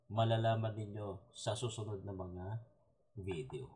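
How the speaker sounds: normal talking voice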